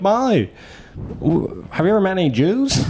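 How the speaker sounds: high, put-on voice of the friend asking; mock quoted friend. To Molly in the car, an imitated high question.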